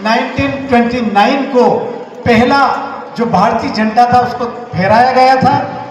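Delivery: ordinary delivery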